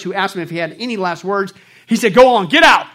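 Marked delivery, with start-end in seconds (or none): 2.1-2.9 loud, high angry shout; screamed. Retold or imitated. Marx dismissing his nurse, loud angry shout. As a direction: bark him out of the room. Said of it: "He screamed at his nurse".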